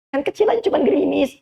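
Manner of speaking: high-pitched voice of a pushy wife; wife prodding her husband to go to the mosque, high and insistent; nagging wife. Acted. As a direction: push him out the door in a high voice.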